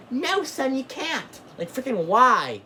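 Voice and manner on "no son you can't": much higher, put-on parent voice saying no; imitating mom turning down her son; mock parent refusal. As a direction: play the parent shutting the kid down, high and hammy. Parent refusing child at store.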